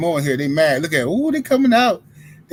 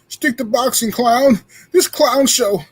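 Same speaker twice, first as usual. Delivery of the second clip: high, loud sneering commenter voice; loud mock hater; mock angry commenter; 7 semitones higher, 7 dB louder, slower than usual. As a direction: sneer it like a troll in chat.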